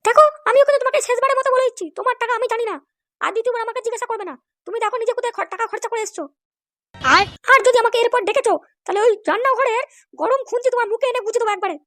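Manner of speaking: high falsetto scolding woman; angry wife. Acted. Wife denying she took husband's money, high falsetto scolding.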